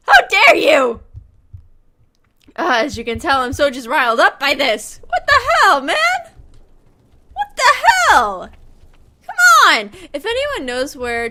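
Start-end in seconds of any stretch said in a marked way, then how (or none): 0.1-1.0 loud, hammed-up how dare you; theatrical indignant cry; mock outrage. Mock outrage at game character. 5.1-9.9 high, loud frustrated what the hell; rising exasperation; exasperated yells. Real, not acted. Streamer yelling at game character.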